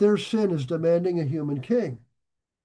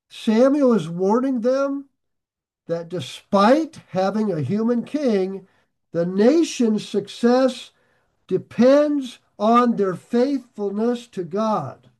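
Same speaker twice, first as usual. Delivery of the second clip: emphatic preaching; 3 semitones higher, slower than usual. Acted.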